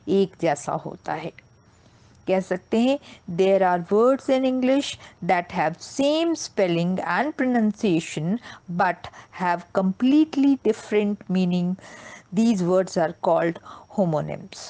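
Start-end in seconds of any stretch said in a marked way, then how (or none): 3.4-14.7 very slow, word by word definition; slow deliberate teacher reading; slow dictation. Shown as a demonstration. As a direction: dictate it slowly for notes. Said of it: "teacher dictating definition slowly".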